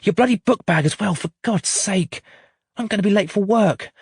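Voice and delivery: mother orders her daughter to stop crying; scolding mum. A narrator's reading.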